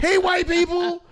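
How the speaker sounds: high, loud stage holler; top-of-lungs yell. Acted. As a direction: yell it across the whole room as a comedy bit. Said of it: "Top of my lungs".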